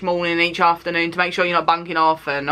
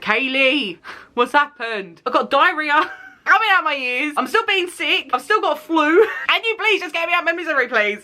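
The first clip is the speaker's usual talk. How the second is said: sick self; 7 semitones higher than usual. Retold or imitated. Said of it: "I said".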